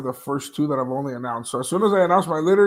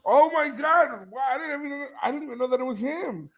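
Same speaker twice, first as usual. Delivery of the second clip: real amazement; 9 semitones higher than usual. Real, not acted. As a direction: marvel at it in a much higher voice. Realises who Max is.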